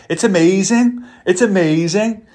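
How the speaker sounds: sing-song Scouse, high and lilting; exaggerated Scouse with a high rising lilt; imitated Scouse accent. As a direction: mimic a friend putting on Scouse, pitch jumping up. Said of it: "He says amazing in my Scouse accent".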